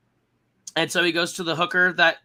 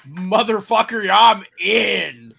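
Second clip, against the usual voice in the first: loud, high, triumphant yes; hammed-up eager agreement; gung-ho acceptance; 4 semitones higher, 8 dB louder, slower than usual. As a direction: belt it out as an all-in declaration. Acts out delighted yes to drinks.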